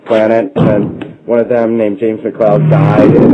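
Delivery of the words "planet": ordinary talk, nothing marked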